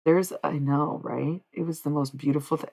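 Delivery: usual speaking voice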